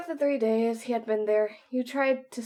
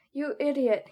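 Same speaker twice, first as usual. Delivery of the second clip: louder, higher, mildly upset rebuke; 5 semitones higher, 5 dB louder than usual. A narrator's reading. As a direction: scold with a touch of upset. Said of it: "clearly upset".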